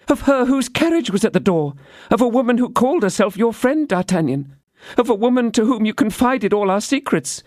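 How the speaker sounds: put-on high young woman's voice, earnest; Constance, innocent. A narrator's reading. Constance explains to D'Artagnan, high and innocent.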